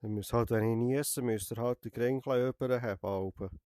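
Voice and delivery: ordinary talk, nothing marked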